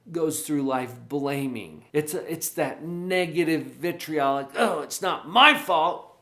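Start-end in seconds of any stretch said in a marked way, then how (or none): 4.6-6.2 a mock whiny excuse, louder; whiny victim. Shown as a demonstration. Coach shows a parent's whiny victim excuse. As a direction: play the blaming victim.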